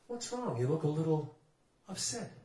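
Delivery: softly asked, mild concern; quiet check-in with an upset stranger; gentle concern. Retold or imitated. Man asks upset woman at the bar, gently concerned.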